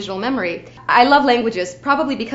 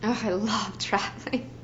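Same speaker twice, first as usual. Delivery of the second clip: soft laughing; 8 dB quieter than usual. Real, not acted. Soft delighted aside.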